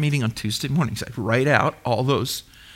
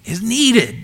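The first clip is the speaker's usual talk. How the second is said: NEEDED pushed higher; preacher hammering the phrase home, higher and emphatic; 7 semitones higher than usual. Real, not acted. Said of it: "Do you get that?".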